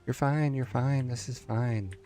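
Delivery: high, anxious reassuring; soothing it nervously, pitch up; shakily calming oneself down. Real, not acted. Soothing a wobbling build piece.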